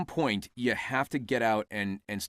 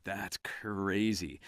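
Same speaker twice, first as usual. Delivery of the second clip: soft, very drawn-out wonder; retold amazement; 3 semitones lower, 6 dB quieter, much slower than usual. Actor reacting to his character's demise, hushed slow amazement. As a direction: take it in slowly, under your voice.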